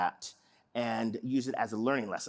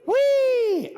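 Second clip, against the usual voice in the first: high loud squeal; roller coaster wee; 7 dB louder than usual. Shown as a demonstration.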